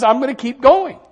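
loud, high, driving preacher cadence; full-force preaching. Acted. Preacher rousing the congregation about Job, full-force preaching. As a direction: build it up and hammer each line.

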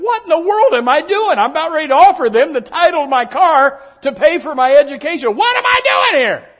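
high, loud, frantic inner voice; retold panic. Young student panicking over paying tuition, high and frantic. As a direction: relive the panic at the counter. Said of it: "remember standing there thinking".

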